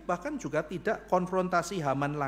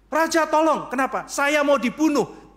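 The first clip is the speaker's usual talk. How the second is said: much higher and louder, begging for help; desperate plea; 9 semitones higher, 8 dB louder than usual. Acted. Esther begging the king for her life. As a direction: cry out for rescue.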